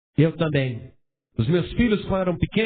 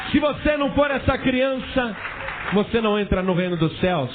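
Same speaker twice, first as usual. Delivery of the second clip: fervent preaching; 5 semitones higher than usual. Acted. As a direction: drive the promise home to the congregation. Preaching at full force.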